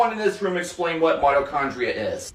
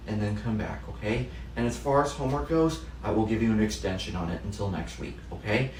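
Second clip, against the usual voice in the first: soft, hushed sympathy; tender comfort; 6 semitones lower, 8 dB quieter than usual. Acted. Teacher softly consoling a girl whose grandmother died. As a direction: console a grieving girl gently.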